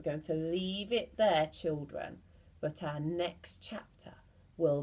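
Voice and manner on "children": ordinary delivery, nothing marked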